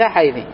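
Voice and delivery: حيثي as haythi, ending in "i"; final kasra. Shown as a demonstration. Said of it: "يجوز أن أقول".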